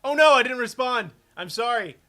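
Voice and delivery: loud, high, dismayed apology; real loud panicked exclamation; alarmed outcry. Real, not acted. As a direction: cry out in sudden dismay. Alarmed at missing a response.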